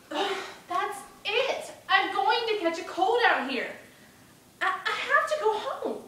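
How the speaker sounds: put-on loud, high-pitched boyish outburst; fed-up young Bruno. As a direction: complain loudly, desperate to get home. Voices Bruno fed up in rain.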